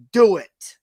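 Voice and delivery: loud, forceful order; villain loudly urging his opponent on; demanding villain. A narrator's reading. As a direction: bark it at her to strike you.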